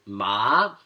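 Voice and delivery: MA with rising pitch; second tone. Shown as a demonstration. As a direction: glide "Ma" upward like a question. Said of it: "you're increasing the pitch".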